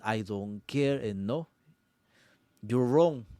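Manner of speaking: usual speaking voice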